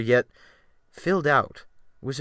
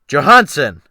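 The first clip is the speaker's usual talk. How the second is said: loud call across the deck; loud, higher summons across the deck; 4 semitones higher, 13 dB louder than usual. A narrator's reading. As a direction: shout the name to summon him. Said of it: "Wolf Larsen called out".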